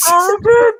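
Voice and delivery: high, loud, squealing through laughter; crying with laughter. Real, not acted. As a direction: lose it completely on dude. Cracking up at the comic.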